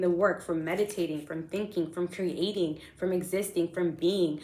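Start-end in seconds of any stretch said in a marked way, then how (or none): none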